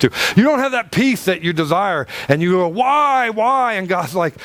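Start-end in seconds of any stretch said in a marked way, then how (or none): 2.7-3.7 mock whining plea. Mock plea of the empty believer.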